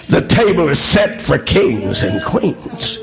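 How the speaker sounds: normal talking voice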